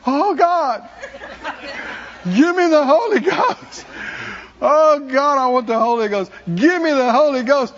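pleading prayer, partly laughing; retold desperate pleading. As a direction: beg God earnestly for it. Pleading at the altar.

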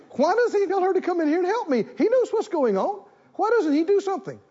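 high, fast, complaining hostess voice; fretting Martha. Acted. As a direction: whine it like a harried cook short on time. Preacher acts out fretting Martha.

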